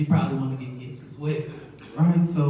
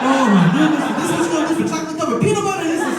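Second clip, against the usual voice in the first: much higher, louder, fast; mock disbelief; 12 semitones higher, 8 dB louder, faster than usual. Father disbelieving his son would trade for chocolate, high fast disbelief. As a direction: sputter it out in disbelief.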